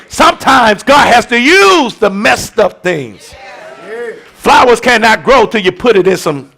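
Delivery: loud, driving sermon cadence; full-force preaching. Acted. Pastor urging the congregation, preaching at full force.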